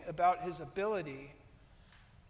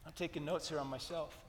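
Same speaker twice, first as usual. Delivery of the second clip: ordinary delivery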